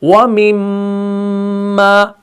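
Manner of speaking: व मिम्मा रज़क़नाहुम slow, the mm of मिम्मा held and nasal with lips closed; recited ghunna. Shown as a demonstration. Teacher reciting the verse to show meem ghunna. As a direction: hum the doubled m in मिम्मा. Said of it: "होंठ अपने बंद करते हैं".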